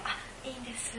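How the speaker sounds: hushed, acted-out polite no; polite soft refusal. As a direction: decline quietly, as a reticent mother. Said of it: "お母さんいますよね".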